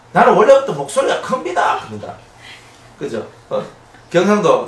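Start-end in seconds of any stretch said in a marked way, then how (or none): 0.1-1.8 higher and louder, mock boast; loud-voiced excuse. A loud man defending his big voice, mock loud. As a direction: blurt it out like a proud loudmouth. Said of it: "보통 사람은 ... 그럽니다".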